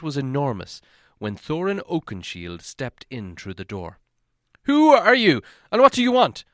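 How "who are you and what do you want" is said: high, loud challenge; startled guards yelling at an intruder; shouting guards. A narrator's reading. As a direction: guards leaping up shout at Thorin. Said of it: "they shouted".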